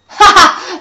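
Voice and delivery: loud, high evil cackle; witch cackle. Acted. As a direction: cackle in triumph. Witch laughs at her plan.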